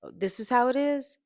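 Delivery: loud, high indignant challenge; rising anger. A narrator's reading. Cornered man challenging his ex, anger creeping in. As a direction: throw it back as an affronted question. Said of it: "Sean's anger was creeping in as he replied".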